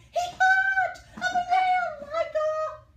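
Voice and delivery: high, loud, slow excited narration. A narrator's reading. Teacher narrates the frog hopping, high and loud. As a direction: build up the thrill.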